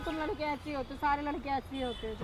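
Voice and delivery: sobbing out her blame at him; crying softly. Real, not acted. Girlfriend crying at boyfriend over his ex, tearful. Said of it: "तो रो मत".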